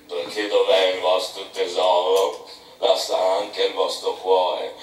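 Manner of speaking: Bildad muttering. A narrator's reading. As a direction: grumble it to yourself, low and slow. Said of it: "e poi tornò a borbottare".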